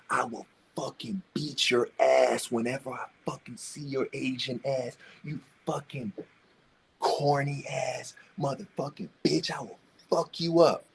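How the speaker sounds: bully's video threat, in a hushed sneering voice; mock thug threat.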